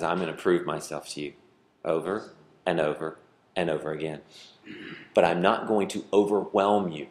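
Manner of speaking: soft, low, gentle promise; a hint of a calm fatherly God; tender voice of God. Preacher voicing God's quiet promise to the people, low and soft. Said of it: "God says".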